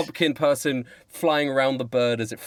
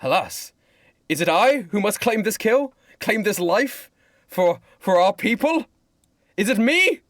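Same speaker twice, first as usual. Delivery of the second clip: hammed-up stage soliloquy, rising higher; William Shakespeare; 9 semitones higher, 3 dB louder than usual. Playwright agonises over who claims the kill, grand declaiming. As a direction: declaim it theatrically with long pauses, building high. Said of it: "William Shakespeare goes".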